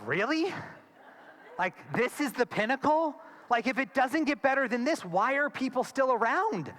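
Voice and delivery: put-on high, incredulous teenage disbelief. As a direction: scoff at the idea like a miserable fourteen-year-old. Teen boy's inner reply to uncle.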